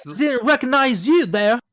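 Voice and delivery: high, loud, deliberately bad Cockney. Cohost mocking Gavroche greeting Javert, in bad Cockney. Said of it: "Cockney is pretty easy".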